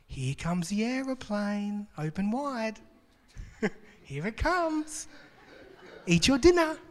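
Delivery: put-on high, slow, coaxing baby-feeding voice with a laugh; parent feeding a child. Writer pictured as a parent spooning dinner to a child, singsong and coaxing. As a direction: coax a toddler to open wide. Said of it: "It's like feeding a child".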